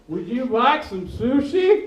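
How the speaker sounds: slow, spaced-out words for the deaf; slow overarticulated. Acted. Mocking helpers signing, slow exaggerated question. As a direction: drag every word out carefully. Mimics slow sign language speech.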